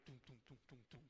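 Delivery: low, soft run of thudding dooms; quiet, low-pitched thuds in a row; imitated footsteps. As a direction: mimic little feet thumping on the stairs. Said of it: "coming down the stairs".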